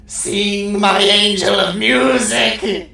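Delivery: high, loud, dramatic Phantom voice; hammed-up opera villain, dragging the end. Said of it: "he's all like".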